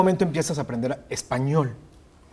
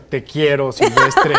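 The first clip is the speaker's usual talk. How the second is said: mock quote; 8 dB louder, slower than usual.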